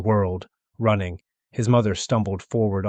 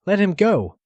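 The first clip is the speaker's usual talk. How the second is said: much higher, louder command; aunt's command; 11 semitones higher, 6 dB louder than usual. A narrator's reading. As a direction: order them to release him. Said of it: "the ant said".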